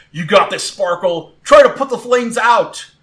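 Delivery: loud urging, climbing high; calling out. Acted. Trainer urges burning Sparkle on, calling out. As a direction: urge your fighter on from the sidelines. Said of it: "stiffen up and call out".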